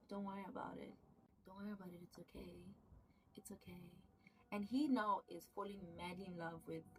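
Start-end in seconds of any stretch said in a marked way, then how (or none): 0.1-3.8 soft, hushed reassurance, fading to a whisper; calm him down gently, almost whispering; soft soothing. Retold or imitated. Lover soothing a nervous friend, soft and hushed.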